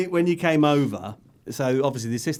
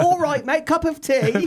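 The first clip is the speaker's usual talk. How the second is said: very high mock British voice; faux British accent; 12 semitones higher than usual. Host mimicking the crew offering tea, faux British accent. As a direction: ham up a cheery British caricature, high-pitched. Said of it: "Like this faux British accent".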